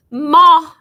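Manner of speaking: loud high call for Ma; desperate loud call to mother. Acted. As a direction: call out to Mama across the yard. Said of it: "I called".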